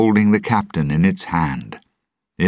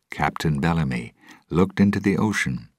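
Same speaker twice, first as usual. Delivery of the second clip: ordinary delivery, nothing marked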